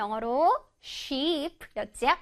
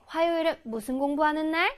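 usual speaking voice

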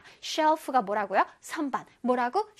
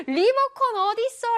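higher-pitched acted "where is it" call; calling out a search; 9 semitones higher, 4 dB louder than usual. Teacher acts out hunting for the remote, calling out high. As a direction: call out as if hunting for a lost item.

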